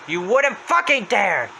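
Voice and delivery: high, slow outburst; Happy's voice. Retold or imitated. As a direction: blow up on it, stretching the words. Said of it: "comes closer to him saying".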